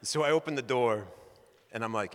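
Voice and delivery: ordinary delivery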